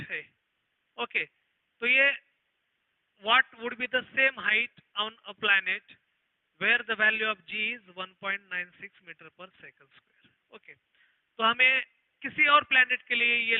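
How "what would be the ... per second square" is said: slow, word-by-word read-out of the question; slow dictation. Acted. Teacher reading an exam question to the class, slow dictation. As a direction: read it out so students can copy it down.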